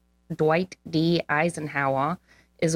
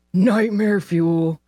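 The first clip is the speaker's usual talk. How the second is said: slow, louder, drawn-out; slow loud mock-horrified remark; mock horror; 3 semitones higher, 5 dB louder, much slower than usual. Real, not acted. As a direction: savour the horror. Reacting to rat king.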